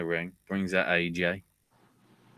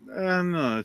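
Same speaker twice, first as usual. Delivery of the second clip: mock Paige; 10 semitones higher than usual. Voicing Paige.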